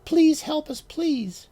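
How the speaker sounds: high, loud frantic begging; desperate pleading. A narrator's reading. Monster dolls begging to be saved, frantic. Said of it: "imagined the monster family shouting".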